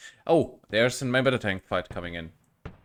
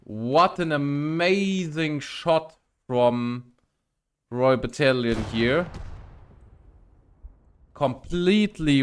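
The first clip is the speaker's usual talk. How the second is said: excited awe; 3 semitones higher, 4 dB louder, slower than usual. Real, not acted. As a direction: marvel at the shot out loud. Caster praises amazing shot.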